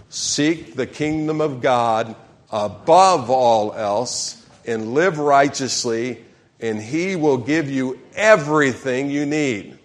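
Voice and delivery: slow, measured congregational reading; leading a group reading. Real, not acted. Pastor leads congregation in reading scripture together, slow and deliberate. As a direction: read it out slowly for everyone to join in. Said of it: "Everybody, let's read this together".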